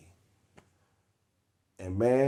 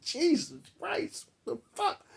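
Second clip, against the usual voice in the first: much higher, strangled squeal of disbelief; shocked squeal. Retold or imitated. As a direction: squeak it out at the price of the hat. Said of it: "I'm like".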